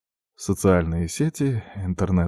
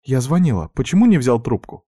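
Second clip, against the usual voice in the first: higher, louder worried reproach; worried sister; 6 semitones higher, 5 dB louder than usual. A narrator's reading. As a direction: sister fretting over missed calls. Worried sister scolding on phone.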